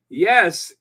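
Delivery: usual speaking voice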